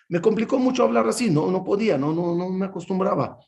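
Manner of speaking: ordinary talk, nothing marked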